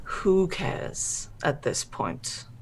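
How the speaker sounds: slow, breathy whisper; whispered menace. Acted. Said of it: "I turn to look at him and I go".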